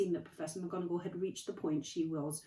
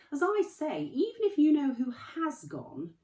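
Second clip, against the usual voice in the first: kindly Dumbledore; 3 semitones higher, 8 dB louder than usual. Acted. Dumbledore voiced, gently chiding.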